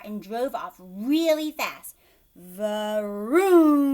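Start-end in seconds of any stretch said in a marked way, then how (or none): 2.3-3.8 roaring engine noise; loud car vroom. A narrator's reading. Narrator voicing the car speeding off, loud vroom. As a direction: make the car zoom loudly. Car sound effect in story.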